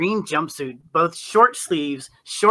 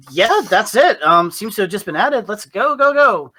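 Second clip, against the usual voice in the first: louder, higher, brisk urging; hurried and eager; 6 semitones higher, 7 dB louder than usual. Acted. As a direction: push everyone to move now. Gnome hurrying the group along.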